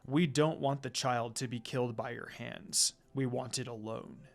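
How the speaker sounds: low, stern and cold. A narrator's reading. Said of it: "he told her in a stern voice".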